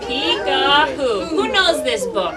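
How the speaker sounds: slow, sing-song storytime voice; reading to a toddler. Acted. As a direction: draw the kids into the book. Reads to children.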